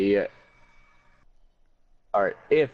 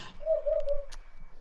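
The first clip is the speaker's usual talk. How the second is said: squealing laugh; 7 dB quieter than usual. Real, not acted. Laughing at own joke.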